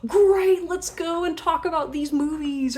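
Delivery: light up like you found a kindred spirit; sudden delight. Pretend. Friend discovering shared hobby.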